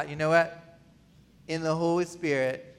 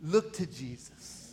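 soft pleading; 4 semitones lower, 8 dB quieter, slower than usual. Retold or imitated. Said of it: "We're begging you".

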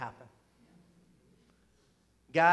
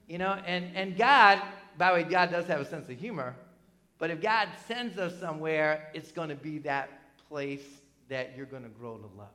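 normal talking voice

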